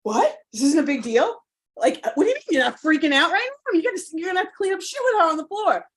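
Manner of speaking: shocked disbelief. Retold or imitated. Mom telling her mother about the mess, in retold disbelief. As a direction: blurt it out like you can't believe she's so calm. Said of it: "And I remembered, like".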